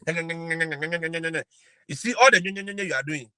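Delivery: droning nyeh-nyeh mimicry, drawn out; mocking babble. Imitating grumbling teachers in the staff room, mocking. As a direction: mimic pointless chatter in a slow drone.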